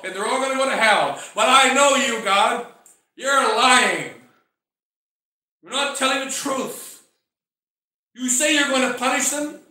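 loud indignant complaint; preacher acting out an outraged prophet; accusing. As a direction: argue hotly, throwing the accusation upward. Jonah acted out accusing God.

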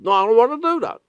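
high, whiny refusal; high sulky no; stubborn refusal. Retold or imitated. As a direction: whine it back like a sulky kid. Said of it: "Soterios said".

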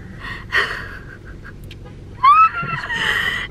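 squealing laugh; high laughing squeal. Real, not acted. Laughs at own mistake.

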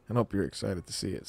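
ordinary talk, nothing marked

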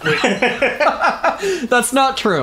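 very slow, stretched high denial; drawn-out denial. Pretend. Taking back a joke about siblings, very slow and high. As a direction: drag it out, high and slow, in mock protest.

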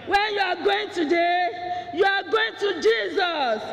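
fervent pulpit preaching; full-force preaching. Acted. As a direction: drive the sermon home with fire. Preaching the Zacchaeus climax.

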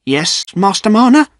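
high, mildly nervous reply; trembling a little. A narrator's reading. Poacher answering his accuser, slightly nervous. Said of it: "trembling a little".

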